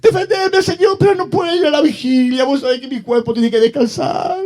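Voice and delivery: súplica fingida, chillona y lastimera; cristiano quejoso. Acted. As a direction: ruega con voz agudísima, poniendo excusas. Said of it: "Ahí está el cristiano". (parodia de lloriqueo al rezar)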